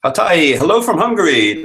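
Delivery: bright, loud, high hello to a viewer; excited greeting. Real, not acted. Greeting a viewer from Hungary, excited and loud. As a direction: call out the hello with glee.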